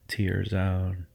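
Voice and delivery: usual speaking voice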